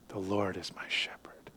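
softly whispered scripture. Acted. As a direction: breathe the words like a secret. Whispered psalm line in sermon.

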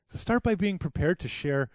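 normal talking voice

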